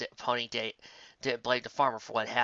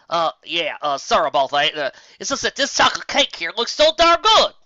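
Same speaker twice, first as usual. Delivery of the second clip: loud, high, embarrassed cover-up; flustered excuse; 5 semitones higher, 12 dB louder than usual. Acted. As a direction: blurt out an excuse after being caught staring. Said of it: "stated the orange mare".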